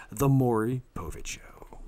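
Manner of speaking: breathy whispered sponsor tag; hushed announcer whisper; whispered ad read. Acted. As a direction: whisper the show name like a promo. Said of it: "I'm reading commercials".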